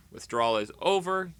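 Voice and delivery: usual speaking voice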